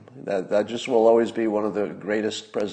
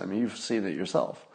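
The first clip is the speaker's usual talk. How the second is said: normal talking voice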